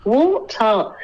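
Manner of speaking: Water with a British t, no r; Water pronunciado a la británica; British pronunciation. Shown as a demonstration. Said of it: "se dice water".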